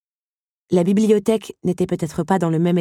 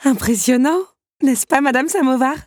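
bright, eager exclamation; exclaiming; 6 semitones higher than usual. Acted. Belle exclaiming to Madame Samovar. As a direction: show off the room with delight. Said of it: "s'exclama Belle".

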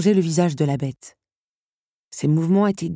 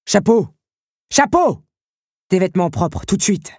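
loud summons down a corridor; calling out; 3 semitones higher, 5 dB louder than usual. A narrator's reading. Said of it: "lança-t-il dans le couloir". (appel lancé fort dans le couloir)

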